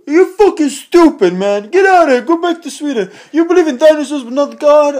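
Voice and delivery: high, loud, scolding comedian impression; indignant, high-pitched celebrity impersonation; Tracy Morgan. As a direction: berate them in an exaggerated high voice. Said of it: "he talks really funny".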